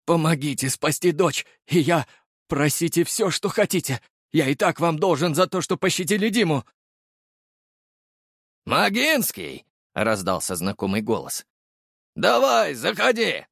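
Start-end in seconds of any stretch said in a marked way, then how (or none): 0.0-6.6 high-pitched begging, wavering voice; father imploring at the edge of despair; desperate pleading. A narrator's reading. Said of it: "его голос дрогнул". 8.7-9.6 high, drawn-up hail; raised-pitch summons by surname; calling out. A narrator's reading. Captain calls the hero from afar. 12.1-13.5 loud high beckoning call; hearty raised-pitch summons. A narrator's reading. Captain calls the hero inside.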